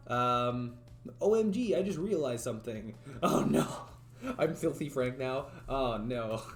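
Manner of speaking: usual speaking voice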